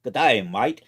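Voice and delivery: loud, hammed-up Crocodile Dundee Australian; bad Australian accent. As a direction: belt it out in a badly faked Australian accent. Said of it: "use my bad Australian accent".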